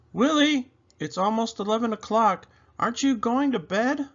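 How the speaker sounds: high, gentle mother asking; mother's voice. A narrator's reading. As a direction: voice Mama calling him to bed. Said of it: "Mama asks".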